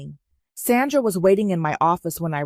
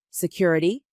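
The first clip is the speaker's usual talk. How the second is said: ordinary delivery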